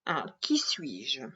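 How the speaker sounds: hushed, slow mysterious whisper; breathy game-title whisper. Acted. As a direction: whisper the riddle title like a secret.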